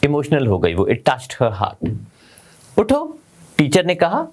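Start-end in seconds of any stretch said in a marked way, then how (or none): 2.8-3.2 a high, gentle call to wake her. Acted. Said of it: "प्यार से कहा". (प्यार से ऊँची आवाज़ में पुकार)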